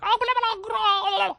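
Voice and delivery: very high garbled babble; mock a drunk woman's unintelligible squawking; incomprehensible heckler. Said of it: "something I couldn't even understand".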